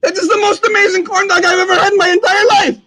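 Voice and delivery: high, loud exclamation of sheer delight; hammed-up rave. Host raving over a first bite of corn dog, hammed-up excitement. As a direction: shout it out in over-the-top amazement.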